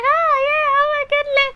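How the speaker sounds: very high, loud falsetto gush; mock besotted woman squealing along; lovestruck squeal. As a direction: swoon excitedly in a put-on, sky-high voice. Besotted woman cheers him on.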